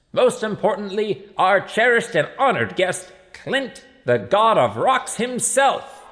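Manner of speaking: high, loud showman's announcement, building to the end; mayor introducing guest of honor. A narrator's reading. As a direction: announce the star guest with fanfare.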